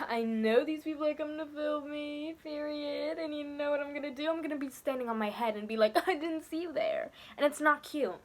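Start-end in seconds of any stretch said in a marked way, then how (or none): 0.1-7.1 lightly voiced smug show-off, a touch higher; mocking show-off. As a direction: sneer at him with a mild mock-smug voice. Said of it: "He was like".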